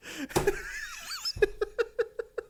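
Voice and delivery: high wheezing laugh; real laughing fit. Real, not acted. Comic cracking up at his own story, wheezing laughter.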